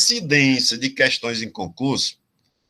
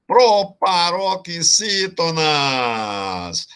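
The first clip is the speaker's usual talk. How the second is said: very slow, stretched syllables, higher pitch; drawn-out term; 5 semitones higher, much slower than usual. Shown as a demonstration. Teacher announcing the rule to the class, drawn-out term. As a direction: draw out every syllable of "proparoxítonas".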